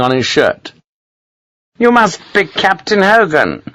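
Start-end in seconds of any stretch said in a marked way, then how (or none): 1.8-3.6 high-pitched officer's greeting; imperious colonel. A narrator's reading. Colonel addressing newly arrived captain, imperious. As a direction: size him up in a raised, lordly pitch.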